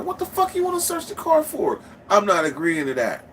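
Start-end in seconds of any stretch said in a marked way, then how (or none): 0.0-1.8 high-pitched indignant squawk; mock-outraged high voice; indignant retort. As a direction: shoot way up in disbelief.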